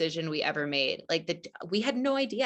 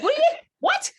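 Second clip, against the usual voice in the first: much higher, louder disbelieving what; incredulous shout; 8 dB louder than usual. Retold or imitated. Stunned the husband wants the dog, an incredulous high shout. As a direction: yelp out the disbelief.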